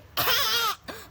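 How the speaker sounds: put-on harsh, loud, high baby scream; grating angry infant wail; too-aggressive baby screech; aggressive baby cry. Shown as a demonstration. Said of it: "That's, like, too aggressive".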